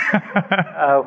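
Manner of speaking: airy laugh; high breathy laugh. Real, not acted. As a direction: laugh breaking out mid-sentence. Laughing at own joke.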